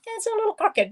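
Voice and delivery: high, laughing admission; amused. Real, not acted. Crafter owning up to crooked letters, laughing. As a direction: say it through a real laugh.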